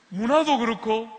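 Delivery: ordinary talk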